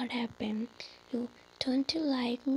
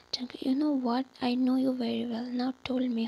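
ordinary delivery, nothing marked

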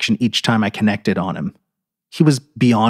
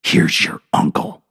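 slow cruel whisper. A narrator's reading. Narrator whispering before choking the trucker. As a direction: breathe it slowly as a threat. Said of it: "I whispered".

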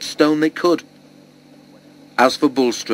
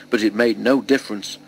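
ordinary delivery, nothing marked

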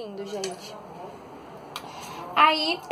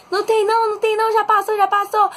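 high, repeated mock call; imitando homem gritando; 9 semitones higher, 3 dB louder than usual. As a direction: call out the all-clear like a man in the street. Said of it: "E ele, e ele gritando lá". (imitando alguém gritando, voz aguda)

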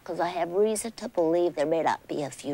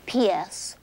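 ordinary talk

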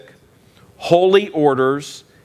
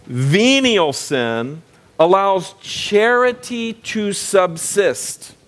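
very slow, each word laid out; slow, deliberate teacher read; slow emphasis; 5 semitones higher, much slower than usual. Shown as a demonstration. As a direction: spell it out slowly for the class. Teacher reading key definition.

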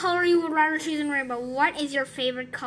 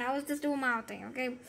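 ordinary delivery

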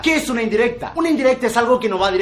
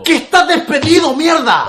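higher, louder blunt yell; exasperated shout; 7 semitones higher, 7 dB louder than usual. Acted. Boss to slow employee, finally shouting the firing. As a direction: lose patience and bellow it.

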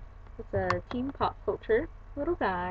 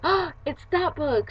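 higher, drawn-out delighted gasp; excited discovery; 7 semitones higher, 4 dB louder, slower than usual. Retold or imitated. Telling viewers how she found the book, excited discovery. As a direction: re-enact a thrilled find, slowly. Retelling spotting the sought book.

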